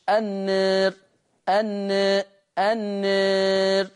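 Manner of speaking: النار with the alif leaning toward e and a thin, softened راء; with taqlil. Shown as a demonstration. Teacher demonstrates Warsh's taqlil on النار. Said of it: "يبتدي يقللها ورش".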